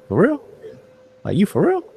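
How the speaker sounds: "For real?" swooping up high as a question; disbelieving "For real?" going way up; rising question tune. Shown as a demonstration. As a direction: ask "For real?" with a sharp upward rise. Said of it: "your voice gets high, higher pitch".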